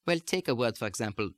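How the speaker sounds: ordinary talk, nothing marked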